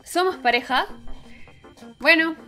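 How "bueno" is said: ordinary delivery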